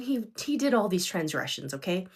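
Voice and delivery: usual speaking voice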